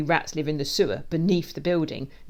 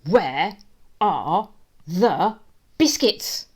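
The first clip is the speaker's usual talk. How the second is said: loud, word by word, rising; menacing demand; 4 semitones higher, 11 dB louder, much slower than usual. A narrator's reading. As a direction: punch each word apart, climbing to a yell. Bully demands biscuits while gripping neck.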